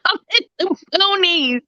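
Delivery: half-hearted mock sob about the boonies; mock whine. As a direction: wail it like a spoiled kid. Complains about being in country.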